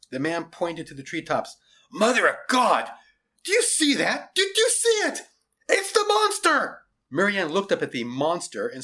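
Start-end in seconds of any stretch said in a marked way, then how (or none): 1.9-6.8 loud cries climbing very high; alarmed shouting. A narrator's reading. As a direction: shout in rising panic at a monster. Man points at monster, alarmed.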